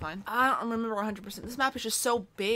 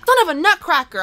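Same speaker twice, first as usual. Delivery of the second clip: frustrated outburst; 6 semitones higher, 11 dB louder than usual. Real, not acted. Frustrated after getting stuck.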